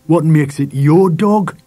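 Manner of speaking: slow, menacing challenge; awful voice. A narrator's reading. Father disputes son's claim to the dog, menacing. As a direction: draw the question out menacingly. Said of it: "said Dad in an awful voice".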